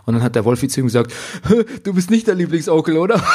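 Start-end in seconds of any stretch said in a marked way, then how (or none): 1.5-3.2 mock indignant uncle. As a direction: blurt it out like the tactless uncle. Uncle blurts it out. (empörte Frage des Onkels, höher und lauter)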